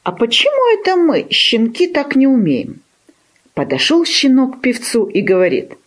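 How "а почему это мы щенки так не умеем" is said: high-pitched, offended little puppy; hurt puppy. A narrator's reading. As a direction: protest in a high, aggrieved voice. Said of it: "и стало ему обидно".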